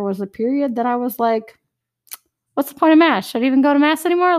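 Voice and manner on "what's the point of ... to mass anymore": loud, high, fast questioning; mock exasperated outburst; despairing doubt. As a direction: blurt out the doubt in frustration. Retold low point, questioning mass.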